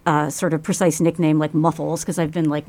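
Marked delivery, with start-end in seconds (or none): none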